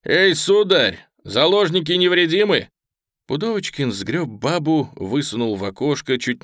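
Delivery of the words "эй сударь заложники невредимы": high, loud hail across a distance; calling out. Acted. Attacker calls from behind a grave mound, shouting. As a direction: shout over to the house. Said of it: "крикнул".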